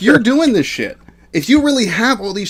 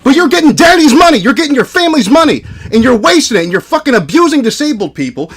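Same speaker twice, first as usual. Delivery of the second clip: higher-pitched, heated accusation; angry berating; 4 semitones higher than usual. Real, not acted. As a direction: lay into him in a real argument. Heated argument, berating the other streamer.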